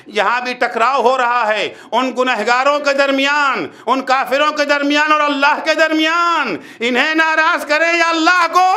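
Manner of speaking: building from firm to high, ringing challenge; forceful preaching. Real, not acted. Preacher confronting believers with a choice, building forceful preaching. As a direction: climb steadily to the final question.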